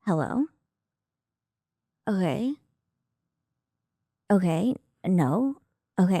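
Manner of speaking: flat, clipped phone answers imitated; partner's phone manner. Shown as a demonstration. Acting out Marco answering a call, flat and terse. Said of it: "Okay, watch this".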